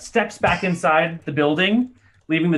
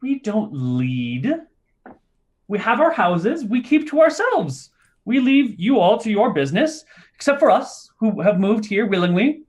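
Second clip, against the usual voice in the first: higher, prim little gnome explaining; 6 semitones higher than usual. Acted. Gnome explaining to visitors how gnomes live, higher voice. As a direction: lecture the big folk primly.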